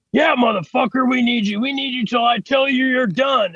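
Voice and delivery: high-pitched mock yell at the new guy; retold angry yell. Sheet metal guy snapping at the new apprentice, mock angry yell.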